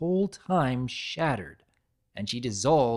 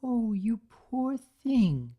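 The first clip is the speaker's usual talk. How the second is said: tender fussing; 9 semitones higher, much slower than usual. Acted. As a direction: coo over the little one. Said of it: "fussing over Kitty".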